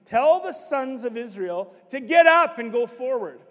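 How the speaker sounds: loud, high firm rebuke; stern call forward; loud stern divine rebuke; God's rebuke. Retold or imitated. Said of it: "he says".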